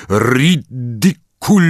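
slow, firm, clearly spoken Ridiculus; a teacher casting steadily and distinctly. Acted. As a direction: take control with one calm spell. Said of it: "bardzo stanowczo i wyraźnie".

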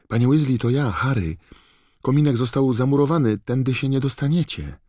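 high, loud call into the wall. Acted. Harry calls through the wall to Mr Weasley, loud call. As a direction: make yourself heard through bricks. Calling through bricked fireplace.

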